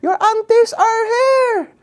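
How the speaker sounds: high, loud mock parent shout; parent calling out. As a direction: call the kid out to dance like an excited parent showing him off to the aunties. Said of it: "your mom or your dad would be like".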